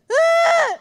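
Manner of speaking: loud, high mock wail of frustration; frustrated baby groan. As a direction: imitate a mad baby giving up. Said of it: "he would get frustrated and mad".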